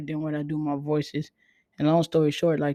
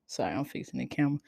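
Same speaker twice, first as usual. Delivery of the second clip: usual speaking voice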